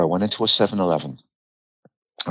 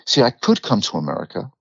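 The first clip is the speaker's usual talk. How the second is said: ordinary talk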